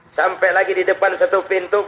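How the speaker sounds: ordinary talk, nothing marked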